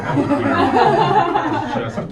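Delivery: stretched out through laughter; laughing. Real, not acted. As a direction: laugh it out slowly. Laughing at sexy intro.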